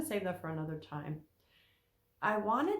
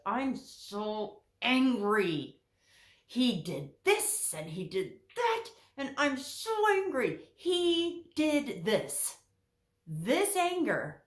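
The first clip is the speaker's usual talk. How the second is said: slow, louder mock complaint with dragged words; imitating angry wife; 4 semitones higher, 4 dB louder, slower than usual. Counselor mimicking a client wife blaming her husband, louder and slow. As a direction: play the aggrieved wife venting her anger.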